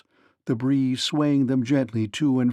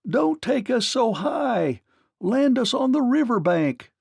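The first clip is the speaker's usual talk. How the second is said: shouting; 8 semitones higher than usual. A narrator's reading. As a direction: call up, begging them down. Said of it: "shouted the Scarecrow".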